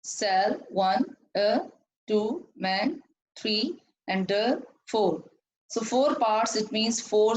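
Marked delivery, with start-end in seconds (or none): 0.0-5.2 "cell, a, man, and the" said as separate beats, each followed by its number; syllable-by-syllable count. Shown as a demonstration. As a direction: break the word into "cell", "a", "man", "and the", counting after each piece. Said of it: "how many parts in this word".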